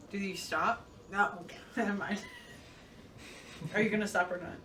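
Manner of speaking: usual speaking voice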